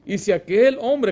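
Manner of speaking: ordinary talk, nothing marked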